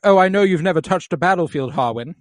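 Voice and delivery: mocking, condescending knight voice, pitched high and loud; smug knight. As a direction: lecture him like a smug veteran. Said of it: "And Criston's like".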